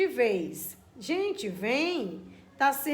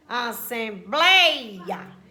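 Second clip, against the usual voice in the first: "Assembleia" slowed, "blei" long and strong; stressed syllable; 3 semitones lower, 4 dB louder, much slower than usual. Shown as a demonstration. Teacher showing a paroxytone, "Assembleia" slow with emphatic "blei". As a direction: stretch "Assembleia", swelling on "blei". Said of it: "é uma paroxítona".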